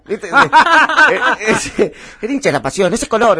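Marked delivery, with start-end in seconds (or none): 2.2-3.4 high, loud vintage movie imitation; hammed-up football-fan monologue; old film tone. Shown as a demonstration. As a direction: declaim it like an old Argentine film. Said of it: "a hacer ese tono".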